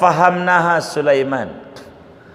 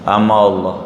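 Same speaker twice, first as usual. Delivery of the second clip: calf realising; 8 semitones lower, slower than usual. Acted.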